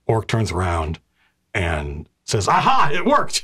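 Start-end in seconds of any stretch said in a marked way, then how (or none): 2.5-3.4 high, delighted orc cry; triumphant exclamation. Acted. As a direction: burst out in sudden triumph. Orc exclaims as summoning works.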